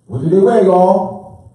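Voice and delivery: loud call to the crowd; rally the hall for an answer; calling out to the room. Real, not acted. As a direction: shout the question out to the audience. Calls out question to audience.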